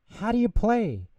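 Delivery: high, loud eager question; excited asking. Acted. Friends beg Bear for the game rules, high and eager. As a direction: bounce with eagerness to learn the game. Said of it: "everyone asked".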